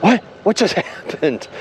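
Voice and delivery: high, bewildered mock reaction; stunned disbelief. Crew after the derailment, stunned and bewildered. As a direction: act out dazed confusion with a laugh. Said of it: "all looking at each other".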